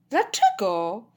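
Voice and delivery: innocent rising question; feigned innocence. A narrator's reading. Girl playing innocent to dad, feigned innocence. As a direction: ask it as if blameless. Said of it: "próbując zrobić niewinną minkę".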